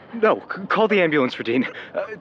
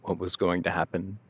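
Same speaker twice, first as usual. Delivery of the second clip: lower, subdued reflective telling; somber narration; 7 semitones lower, 4 dB quieter than usual. Acted. Narrator recalling the night Daniel was hurt, somber. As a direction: look back on it quietly, weighed down.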